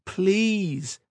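a high, pleading please; begging. Acted. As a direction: beg him to stop. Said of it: "begged Kat".

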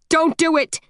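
shouting. A narrator's reading. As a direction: yell to stop a phantom. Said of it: "he shouts".

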